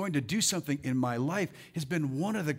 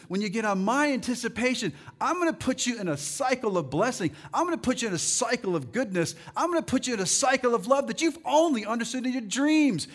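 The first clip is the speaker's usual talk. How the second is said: building to loud, higher-pitched preaching; retold speech driven louder and higher as it goes; impassioned Jesus; 5 semitones higher, 5 dB louder than usual. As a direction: preach the promise with rising force, as Jesus speaking. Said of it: "He's just like".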